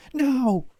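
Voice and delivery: high, loud, frantic cries; distraught screaming. Acted. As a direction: scream it in panicked grief. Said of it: "she starts screaming".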